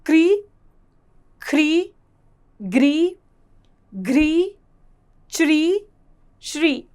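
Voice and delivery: each of क्री, ख्री, ग्री ending in a drawn-out "ree"; ri sound drill. Shown as a demonstration. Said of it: "इसका उच्चारण होता है री". (री का उच्चारण)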